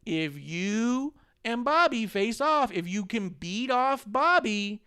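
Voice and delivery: lightly voiced high, slow schmoozing; mock Shane McMahon pitch. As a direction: pitch it up and drag it like a sly promoter. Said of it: "And then Shane goes".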